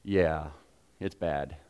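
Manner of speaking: low, quiet, dead serious; a soft grim admission; grave and hushed. Retold or imitated. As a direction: drop it low like real doom. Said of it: "More like".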